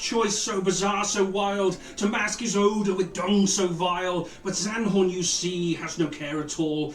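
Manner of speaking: rhyming verse, sung-speech cadence; grand rhythmic bard. Acted. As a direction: recite like a tavern bard.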